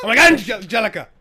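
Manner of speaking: high, loud shocked cry; startled shout. Acted. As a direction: yell her name in shock. Startled player slams tray.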